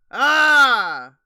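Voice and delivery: long, loud open "aah". Real, not acted. Streamer getting settled after turning round, loud drawn-out "ah". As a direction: let out a loud, falling "ah".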